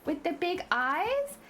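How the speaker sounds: put-on high, slow anime-girl coo; wide-eyed cutesy high voice; high-pitched cutesy voice. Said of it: "I can speak high-pitched as well".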